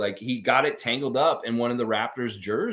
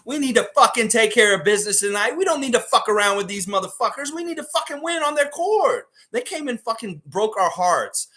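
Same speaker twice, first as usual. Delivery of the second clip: higher, louder rallying rant; rally the fans like a fired-up coach; 7 semitones higher, 4 dB louder than usual. Real, not acted. Streamer pumping up viewers before the Raptors game, higher and louder.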